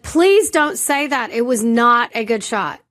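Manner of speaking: mock yell, stretched for emphasis; retold yelling. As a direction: re-enact an exasperated outburst. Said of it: "I said that in the yelling".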